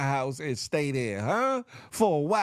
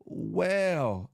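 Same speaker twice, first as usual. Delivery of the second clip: slow drawn-out disbelief; 3 semitones higher, much slower than usual. Retold or imitated. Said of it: "'Cause I said".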